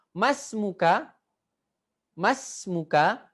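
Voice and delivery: slow, drawn out question; slow model reading. Shown as a demonstration. Teacher drawing out the Arabic question slowly for the class. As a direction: stretch each syllable for them to copy.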